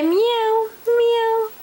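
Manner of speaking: high cat meow; cat meow for child. Shown as a demonstration. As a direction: meow like a kitten.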